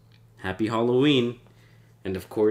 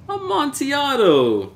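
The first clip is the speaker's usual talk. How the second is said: high, loud, slow cry of longing; drunken rapture; 7 dB louder, slower than usual. Acted. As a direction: howl the wine's name like a man possessed. Fortunato craving the wine.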